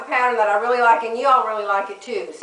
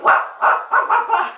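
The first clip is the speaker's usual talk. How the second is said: imitated yapping; 12 semitones higher than usual. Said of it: "he could hear Josie".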